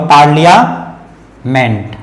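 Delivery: पार्लियामेंट slowly, paar-liya, pause, ment; slow model pronunciation. Shown as a demonstration. Teacher shows there is no long ee, slow model pronunciation. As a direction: split पार्लियामेंट before a falling MENT. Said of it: "ई की मात्रा नहीं होगा".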